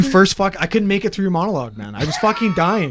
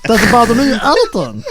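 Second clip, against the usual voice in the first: very high, strained cartoon monster voice; high-pitched creature voice demo; Hormone Monster; 9 semitones higher than usual. Shown as a demonstration. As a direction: put on a comic monster character, laughing through it. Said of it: "I can hold that the whole time".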